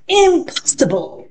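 high, lightly voiced magician; magician's refusal. Magician refusing to change the dog back, high. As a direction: snap it out as the magician. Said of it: "he answered".

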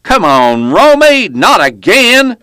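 loud, high, drawn-out exasperated yell. A narrator's reading. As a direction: holler it from a truck window. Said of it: "leaned out and yelled".

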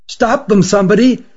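high, urgent call to stop them; alarmed cry. A narrator's reading. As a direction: cry out, pitched well up. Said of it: "cried the one who found them".

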